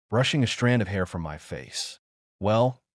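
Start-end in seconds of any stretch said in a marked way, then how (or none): none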